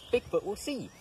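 ordinary delivery

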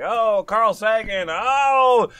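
gushing fanboy, loud and high; excited. Retold or imitated. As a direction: rave like a thrilled fan. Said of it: "'cause he was like".

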